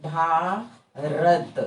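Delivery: Bharat drawn out very slowly, each sound clear; correct pronunciation. Shown as a demonstration. Teacher showing students the right way, very slow. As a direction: spell out Bharat slowly for the class. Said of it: "सही spelling क्या होगा?".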